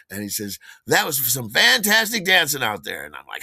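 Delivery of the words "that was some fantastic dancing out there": loud, high-pitched gushing compliment; hearty praise. Retold or imitated. Man in a suit praising a dancer, loud and hearty. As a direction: gush like an over-friendly stranger.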